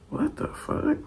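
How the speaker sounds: a breathy whisper, high and soft; whispered disbelief. Retold or imitated. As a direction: mutter it under your breath, baffled. Bewildered reaction to cousin's excuse.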